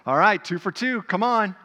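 loud, eager gambler's call; egging on the next roll; gambler at Vegas. Retold or imitated. Abraham as a Vegas gambler on a streak, eager loud call. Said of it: "like going to Vegas".

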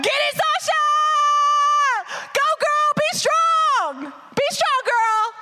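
high, loud hype-man cheer. Retold or imitated. God as hype man cheering Sasha on, high and loud. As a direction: pump her up like a courtside hype man. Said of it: "Jesus is our hype man".

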